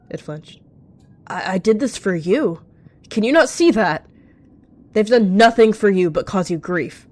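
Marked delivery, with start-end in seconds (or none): 1.3-7.1 desperate insistence. A narrator's reading. Villain pleads his case angrily.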